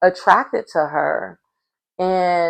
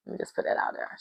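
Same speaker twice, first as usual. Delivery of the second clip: whispered aside; 16 dB quieter, much faster than usual. Real, not acted.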